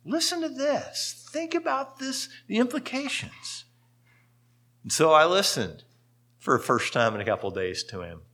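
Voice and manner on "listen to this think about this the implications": soft, unhurried and calm, a touch higher; gentle voice of the Lord. Retold or imitated. The Lord speaking softly to the weary pastor, gently. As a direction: quietly invite him to sit and listen. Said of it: "it's like the Lord said".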